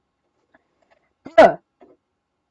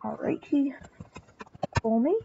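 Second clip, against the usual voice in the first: sneaky whisper; 12 dB quieter than usual. Real, not acted.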